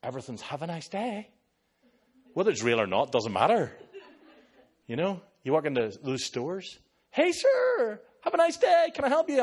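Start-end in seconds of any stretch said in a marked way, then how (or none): 0.4-1.3 lightly upbeat, pitched up; cheery American well-wishing, mildly mimicked. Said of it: "always so flippin' upbeat". 7.1-9.4 very high, loud mock-cheerful greeter; hammed-up perky American store assistant; upbeat American. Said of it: "always so flippin' upbeat".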